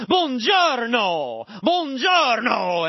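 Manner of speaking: loud, drawn-out fake Italian accent, pitched high; exaggerated Italian accent. Shown as a demonstration. Learner screaming along to language tapes, hammed-up Italian accent. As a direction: ham it up like a movie Italian. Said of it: "I was screaming it in my car".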